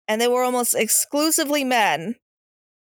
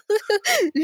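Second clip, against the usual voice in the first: short mocking laugh; 10 semitones higher than usual. Mocking caller, laughs first.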